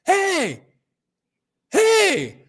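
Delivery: put-on a four-year-old calling from the back seat, high; little boy calling. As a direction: call out like a small eager kid.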